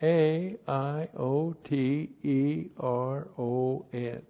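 ordinary delivery

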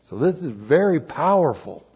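ordinary talk, nothing marked

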